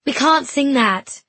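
slow, loud and firm; firm declaration. A narrator's reading. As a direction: put your foot down, slowly. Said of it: "she declared".

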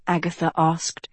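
ordinary delivery, nothing marked

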